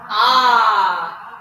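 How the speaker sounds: loud held ah on one note; sung ah note. Shown as a demonstration. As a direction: sing a steady ah. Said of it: "What are we saying?".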